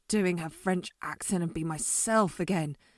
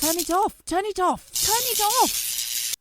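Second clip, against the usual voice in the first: frantic yells climbing higher and higher; panicked shouting; 10 semitones higher, 4 dB louder than usual. Acted. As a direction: panic building with each repeat. Machine sparking out of control.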